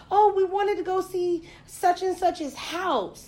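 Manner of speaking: put-on high, slow, clueless valley-girl tone; mocking airy voice. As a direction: mimic a careless friend. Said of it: "They were like".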